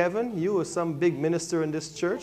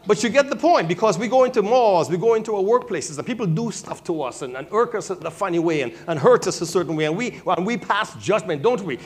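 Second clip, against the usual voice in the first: fervent preaching; 5 semitones higher, 5 dB louder than usual. Real, not acted. Preaching, pressing the congregation.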